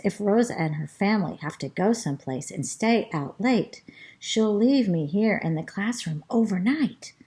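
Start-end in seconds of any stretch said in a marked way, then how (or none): none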